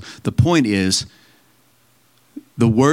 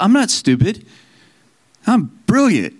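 proud declaration; 10 semitones higher than usual. Shown as a demonstration. Preacher to congregation, proudly declaring she is brilliant. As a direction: declare it proudly over yourself. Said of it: "I should say that over myself".

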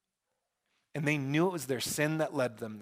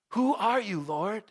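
high-pitched opening, wondering question; Saul questioning God; 5 semitones higher than usual. Retold or imitated. Saul asks the Lord who he is, high and questioning. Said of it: "and he says".

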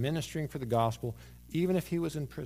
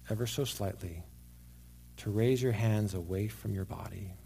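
guided prayer; 4 semitones lower, 3 dB quieter than usual. Acted. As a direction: gently guide them to give it to God. Leads congregation in guided prayer.

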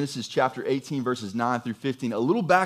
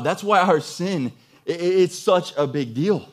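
normal talking voice

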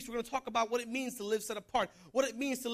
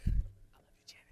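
unvoiced, affectionate whisper; whispered aside. Real, not acted. Preacher to Janet after teasing her, whispered. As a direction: whisper a quick make-up line.

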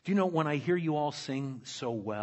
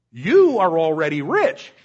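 sarcastic; 3 semitones higher, 13 dB louder than usual. Retold or imitated. Paul mocking the Corinthians' riches, loud sarcasm. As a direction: lay the sarcasm on thick. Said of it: "catching the sarcasm".

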